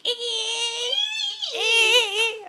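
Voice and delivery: high, wailing dolphin squeals; long piercing dolphin call. Acted. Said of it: "No, it goes".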